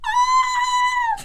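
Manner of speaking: very high, loud imitation wail; mock sobbing wail. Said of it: "I was sobbing in the Bring Me pit".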